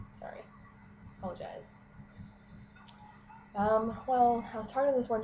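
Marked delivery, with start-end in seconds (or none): 0.1-0.4 muttered apology. Shown as a demonstration. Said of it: "same word quieter". 1.2-1.8 whispered apology. Shown as a demonstration. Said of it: "same word quieter, unvoiced".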